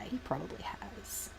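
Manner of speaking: voiceless whispered aside. Real, not acted. Musing about Fulgrim's canon, whispering. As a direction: breathe it out with no voice. Whispered then repeated aloud.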